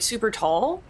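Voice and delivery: normal talking voice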